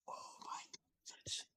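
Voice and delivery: hushed awe, breath only; whispered. Real, not acted. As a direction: whisper it in dread. Awed aside about Sarusan.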